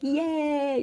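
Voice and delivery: high, drawn-out mock yay; acting out someone's yay; imitated cheer. Imitates the teacher's little cheer.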